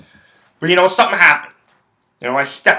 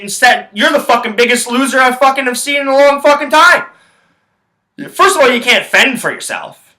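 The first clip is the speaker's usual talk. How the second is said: heated rant; 9 semitones higher, 4 dB louder than usual. Real, not acted. Ranting at the absent man.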